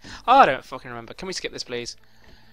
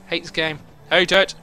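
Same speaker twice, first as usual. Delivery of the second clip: usual speaking voice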